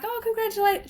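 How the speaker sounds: high-pitched imitation of congratulations; putting on happiness. Husband congratulating the expecting friends, putting on happiness. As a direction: imitate a husband trying to be happy. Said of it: "trying to be happy for them".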